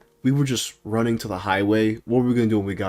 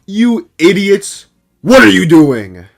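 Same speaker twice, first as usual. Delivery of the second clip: loud, higher, rough yell; imitated angry scolding; 8 semitones higher, 10 dB louder than usual. Imitating brother yelling at the runaways, mock furious shout. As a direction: yell it slowly, then punch the question.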